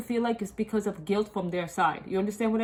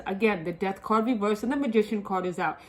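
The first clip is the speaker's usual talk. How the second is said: ordinary talk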